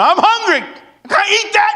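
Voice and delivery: high, loud restaurant whine. Retold or imitated. Husband complaining loudly in the restaurant, high whine. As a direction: whine it out across the table. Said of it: "I'm in a restaurant going".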